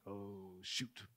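low, very soft sinking realization; hushed oh-no to himself; quiet dismay. Retold or imitated. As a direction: let it drop almost to nothing. Retells his sinking realization.

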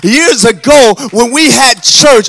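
loud, urgent preaching building to a high shout; full-force preaching. Real, not acted. Preacher rebuking a distracted church, full-force preaching rising to a shout. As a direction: push harder until the last words are long, loud cries.

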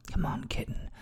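hushed coaxing whisper. Acted. Coaxing in intimate scene.

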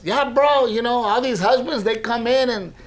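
lightly hammed-up bro; Pollito voice. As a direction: tell it like the cheeky paint guy. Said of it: "then he goes".

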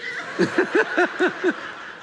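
wheezing giggle; high breathless laugh. Real, not acted. Pastor laughs at the angry reply, high breathless giggle. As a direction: lose it laughing.

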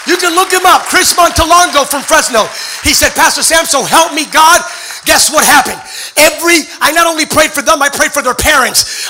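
high, shouted preacher's climax; full-force preaching. Real, not acted. Preacher promises the church their trials will heal others, full-force preaching.

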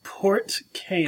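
soft unvoiced whisper, slow. Real, not acted. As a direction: breathe the name in a whisper. Echoing the name in a whisper.

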